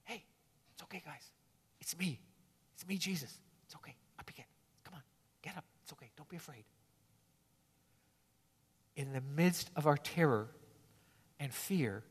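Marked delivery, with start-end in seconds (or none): 0.0-6.6 soft, hushed comfort; Jesus soothing fallen disciples, hushed and tender; gentle reassuring Jesus. Retold or imitated. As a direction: whisper it like lifting someone off the ground. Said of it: "reaches down with his right hand and says".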